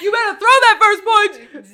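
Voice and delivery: very loud, high, slow dare; mock threat. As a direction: dare them with a laughing yell.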